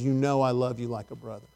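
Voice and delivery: low, hushed, subdued avowal; retold tearful answer. Preacher voices Peter crying before Jesus, hushed and subdued. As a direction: answer softly and low, as if near tears. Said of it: "then Peter starts crying".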